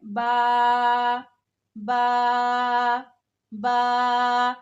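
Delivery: "बा" with the vowel drawn out a little, said loud; slightly long baa. Shown as a demonstration. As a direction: stretch the "बा" vowel just a bit, not too long. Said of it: "थोड़ा सा बस लंबा करना है". ("बा" को थोड़ा सा लंबा करके)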